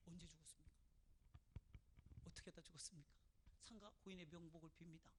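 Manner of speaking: hushed, low funeral sympathy; mock condolence. Visitor consoling a bereaved sister, hushed and solemn. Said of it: "이렇게 위로한답시고 위로하는데".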